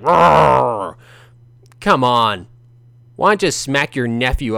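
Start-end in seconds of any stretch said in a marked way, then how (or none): 0.0-1.0 a hammed-up loud roar; mock angry roar. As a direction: roar like a cartoon angry uncle. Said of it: "I'm so angry".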